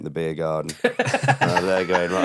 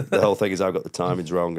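usual speaking voice

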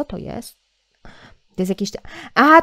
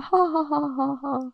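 delighted laughter; 5 semitones higher, 4 dB quieter than usual. Real, not acted. Laughing at the details.

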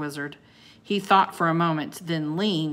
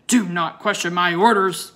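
louder, commanding roar; 9 dB louder than usual. Acted. Wizard roars at Annie to obey his orders. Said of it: "the wizard roared at her".

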